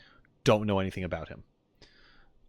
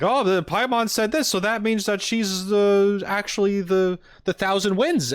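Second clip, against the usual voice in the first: high, loud mock-theorist voice; sustained high, louder mocking quote; imitating overeager theorists; 9 semitones higher, 5 dB louder than usual. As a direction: mimic fans leaping to conclusions, pitched way up. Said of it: "a lot of people are like".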